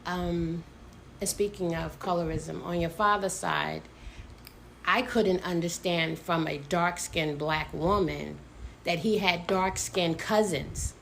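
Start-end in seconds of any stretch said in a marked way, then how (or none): none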